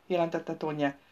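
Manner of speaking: usual speaking voice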